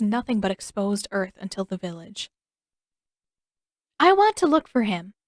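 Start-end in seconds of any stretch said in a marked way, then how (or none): 4.0-5.1 higher, louder declaration; firm announcement. A narrator's reading. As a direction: announce your plan firmly. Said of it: "Katara announced".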